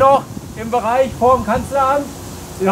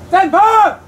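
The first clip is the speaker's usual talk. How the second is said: loud, high rallying shout; battle yell; 7 semitones higher, 6 dB louder than usual. Real, not acted. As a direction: call it out full force. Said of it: "Ein dreifach kräftiges".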